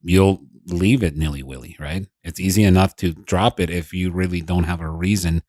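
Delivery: usual speaking voice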